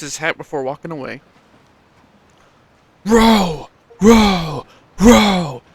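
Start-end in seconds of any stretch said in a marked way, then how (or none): 3.0-5.6 loud chanted rowing call, higher; sailors shouting. Acted. Sailors shouting together to row, loud chant. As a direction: bark out the rowing rhythm. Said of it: "shout the sailors in unison".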